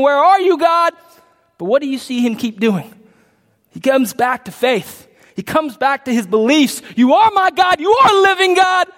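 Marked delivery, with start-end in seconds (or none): 0.0-0.9 higher-pitched cry to God; acted-out desperate question; anguished appeal. Voicing the psalmist's cry to God. 7.0-8.9 high, raised-voice profession of faith; preacher acting out impassioned belief; fervent declaration.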